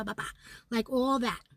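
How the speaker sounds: ordinary delivery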